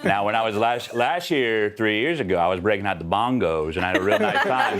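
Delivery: laid-back storyteller with swooping intonation; McConaughey. Shown as a demonstration. Guest spinning a bongo jam story on request, easygoing McConaughey impression. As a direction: lazily roll out the tale, lingering on key words. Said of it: "can we have McConaughey, please?".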